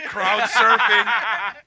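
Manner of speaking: laughing through the words; laughing while telling the story. Real, not acted.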